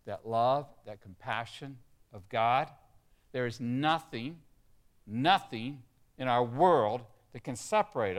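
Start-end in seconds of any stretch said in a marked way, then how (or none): none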